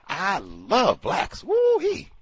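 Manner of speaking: slow then high whoop; imitating Fuller. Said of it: "He said".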